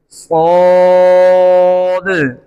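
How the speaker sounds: सौद held long and heavy, final d bounced; slow recitation of सौद with echoing d; qalqala on saad. Shown as a demonstration. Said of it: "इस तरह पढ़ेंगे". (सौद मोटा, दाल पर कलकला)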